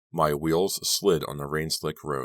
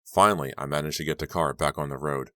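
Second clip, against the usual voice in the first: ordinary delivery, nothing marked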